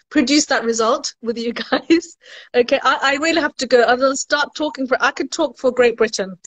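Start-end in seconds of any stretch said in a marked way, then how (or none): none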